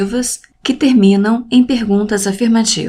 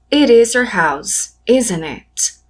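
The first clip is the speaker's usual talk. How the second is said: slow model English; 2 semitones higher, slower than usual. Shown as a demonstration. Teacher modelling English example.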